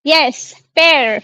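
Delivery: पेर drawn out slowly, French père; teacher models पेर slowly for class; slow French demo. Shown as a demonstration. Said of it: "father को पेर कहा जाता है".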